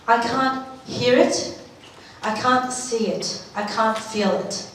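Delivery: normal talking voice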